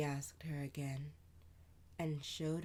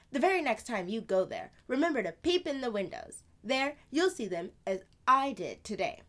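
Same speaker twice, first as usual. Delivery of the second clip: higher, louder, quick big-sister voice; older sister voice; 8 semitones higher, 11 dB louder, faster than usual. Acted. Sister answering Amani, lively and louder. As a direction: play the lively older sister telling a tale.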